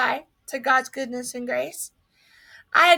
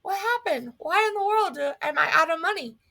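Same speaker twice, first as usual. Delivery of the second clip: stunned disbelief; 7 semitones higher than usual. Retold or imitated. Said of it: "I thought".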